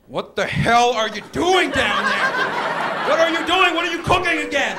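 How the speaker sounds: loud, high-pitched hollering; irate yelling. Acted. Hearing the smoke alarm go off while someone cooks, an irate yell. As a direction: yell furiously at someone downstairs. Acts out angry reaction to alarm.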